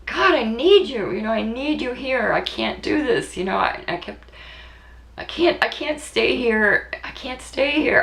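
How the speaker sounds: high pleading cry, close to tears; begging to be taken away; desperate plea. Retold or imitated. Mother retelling her plea to God, high desperate cry. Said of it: "Joey knew I was acting desperate".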